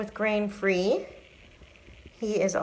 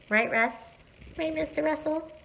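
pet talk; 10 semitones higher, 4 dB quieter than usual. Real, not acted. Cooing at cat Russell.

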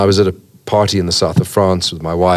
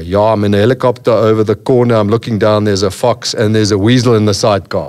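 Afrikaans-accented helicopter pilot, a bit louder; 3 dB louder than usual. Shown as a demonstration. Actor recreating his film lines for the host, Afrikaans accent. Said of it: "An Afrikaans accent".

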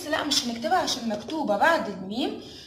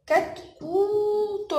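very slow and higher "كتكوت", syllables stretched; slow sounding out; 8 semitones higher, much slower than usual. Shown as a demonstration. Teacher showing a new word to kids, slow and high. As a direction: sing the word out for little ones. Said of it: "ايه اللي ناقص".

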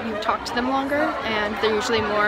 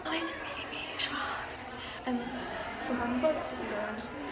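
soft whisper between shelves; 13 dB quieter than usual. Real, not acted. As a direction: whisper so nobody hears. Whispering in the bookstore.

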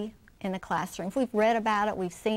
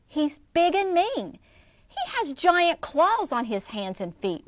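frightened wail; 7 semitones higher, 4 dB louder than usual. A narrator's reading. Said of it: "wailed Cassie".

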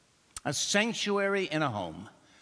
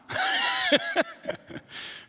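high, open laughter; hearty laugh. Real, not acted. Laughs after punchline.

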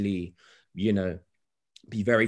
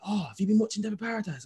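high, quick mum voice; high, fast impression of his mum; imitating his mum; 12 semitones higher, faster than usual. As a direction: put on his mum's voice, way up high. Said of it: "my mum called me, innit, and was like".

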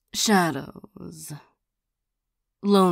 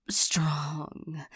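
demonic whisper; 3 semitones lower, 7 dB quieter than usual. Acted. Savoring the listener's emotions.

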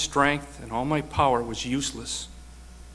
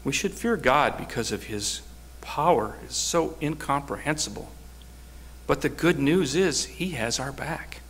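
usual speaking voice

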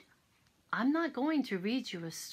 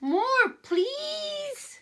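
put-on squeaky, much higher baby mouse; baby mice pleading for more; tiny mouse voice; 14 semitones higher, 6 dB louder than usual.

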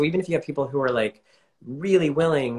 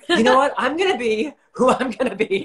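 much higher and louder, a playful defiant declaration; defiant retort; 8 dB louder than usual. Retold or imitated. Answering doubters about a Bollywood career, high and defiant. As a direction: toss it back with cheeky defiance. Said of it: "And I was like".

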